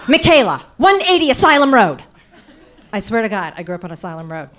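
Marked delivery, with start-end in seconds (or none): 0.1-2.0 a freaked-out aunt bellowing the address; louder, panicked mock yell. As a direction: yell the address out loud, frantic. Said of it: "all she can do is yell out our addresses".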